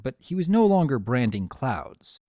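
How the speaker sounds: usual speaking voice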